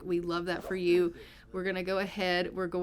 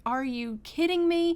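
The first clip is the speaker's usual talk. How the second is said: higher, slow mock outrage; incredulous outrage; 6 semitones higher than usual. Patient hears second epidural failed, incredulous. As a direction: draw it out in playful disbelief.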